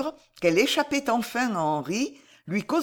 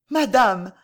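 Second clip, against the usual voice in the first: louder, falling cry in a broken voice; 3 semitones higher, 7 dB louder than usual. A narrator's reading. Kneeling Alice appeals to Jeanne, broken voice. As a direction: appeal to her, stricken. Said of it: "dit-elle d'une voix brisée".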